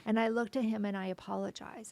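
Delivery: usual speaking voice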